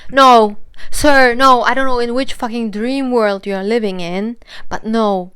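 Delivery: loud, emphatic retold rejection; indignant refusal. Retold firm rejection of his advance.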